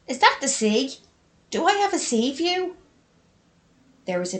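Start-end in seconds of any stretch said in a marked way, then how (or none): 0.1-2.8 high, rising, amazed question; delighted surprise. Acted. May spotting the sea from her window, delighted surprise. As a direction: pitch leaps up in disbelief.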